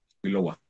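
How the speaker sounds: Ixcuiloa spoken softly as kilowa with no tla-; Ixcuiloa, quiet and clipped at the start; teacher models the transitive Ixcuiloa; transitive form spoken. Shown as a demonstration. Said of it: "en el transitivo decimos Ixcuiloa".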